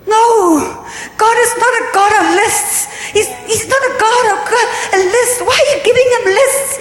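stirred-up preacher challenging the crowd; fervent rebuke. Real, not acted. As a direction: scold them with rising fire. Rebuking the congregation.

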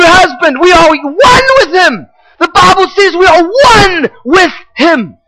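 high, loud, rising proclamation; preaching at full force. Real, not acted. Preacher to church, shouting the good news. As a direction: build to a crying shout of joy.

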